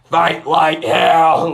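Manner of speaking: slow, high, very loud stage yell; imitated rally shout. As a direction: bellow it like a speaker rousing a crowd. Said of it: "He goes up on stage".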